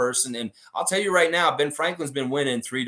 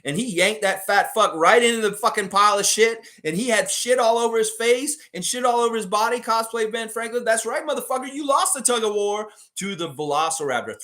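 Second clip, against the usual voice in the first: higher, louder gloating call; hyped up; 7 semitones higher, 3 dB louder than usual. Real, not acted. Streamer celebrating the Raptor's tug of war win, higher and louder. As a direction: crow over the victory like a fight announcer.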